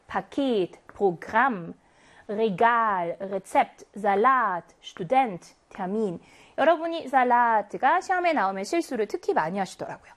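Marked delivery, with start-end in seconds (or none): 0.1-6.2 each word read slowly with the last syllable hit; second-syllable stress. Shown as a demonstration. Teacher reading a word list aloud for students, stressing the end. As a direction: model reading, leaning on the final syllable. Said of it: "이음절에 강세가 오는 경우 읽어보고 갈까요".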